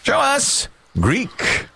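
high, drawn-out game-show call; game-show reveal call. Pretend. Host calling to the board for Italian, drawn-out reveal call. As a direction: call for the board, stretching each word high.

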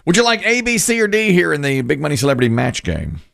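upbeat, high game show host voice. Acted. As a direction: greet the contestant like a cheery TV host. Game show host asking contestant.

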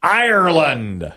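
drawn-out, high-pitched announcer call; game-show reveal call. Real, not acted. Host asks the board to show Ireland, drawn-out reveal call. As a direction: stretch it out for suspense.

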